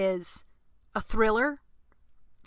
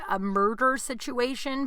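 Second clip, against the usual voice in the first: ordinary talk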